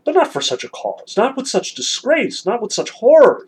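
emphatic protest, climbing high and loud on the repeats; Alyosha objecting. A narrator's reading. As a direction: read as a dismayed objection, building. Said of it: "said Alyosha".

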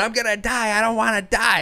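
put-on high, panicky little-kid wail; panicking kid. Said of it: "He's going".

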